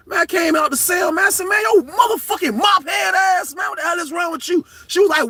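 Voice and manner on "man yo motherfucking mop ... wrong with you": retold furious yelling. Inmate yelling at the officer who opened his door. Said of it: "Man, I snapped".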